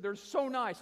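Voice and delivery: high-pitched emphatic gush; imitated high, heartfelt praise. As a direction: marvel as an emotional woman. Retold lady gushes.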